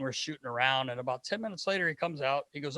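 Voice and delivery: ordinary talk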